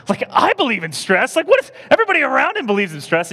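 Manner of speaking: high, loud indignant rant; angry protest. Retold or imitated. Preacher retelling his reaction to the stress remark, hammed-up outrage. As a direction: protest at the top of your range. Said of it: "I was just angry".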